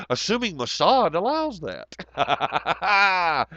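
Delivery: slow, high, wry joke; sarcastic aside. Real, not acted. Streamer signing off with a slow, high, sarcastic joke. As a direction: drag it out with mock paranoia.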